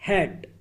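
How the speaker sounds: हैट with a short, clipped a; short vowel hat. Shown as a demonstration. Teacher showing the short vowel in हैट. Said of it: "हैट में जो ए का sound है, वो है ए का".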